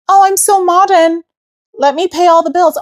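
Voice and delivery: high-pitched mock modern woman. Mocking modern women who pay all the bills, high and mocking. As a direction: parody them in a high, self-satisfied voice.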